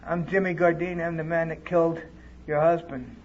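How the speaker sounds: soft, understated retold confession; subdued tearful confession. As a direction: confess it quietly, with only a touch of grief. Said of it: "crying. He said".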